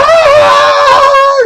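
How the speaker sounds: a high hammed-up shriek, loud; mock scream. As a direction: screech like he's being zapped. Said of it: "He screamed".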